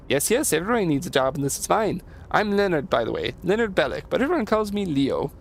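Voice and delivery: bouncy, lilting self-introduction; folksy handyman, pitch hopping up and down; friendly Leo. Acted. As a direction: play an eager, simple helper. Voices Leo introducing himself.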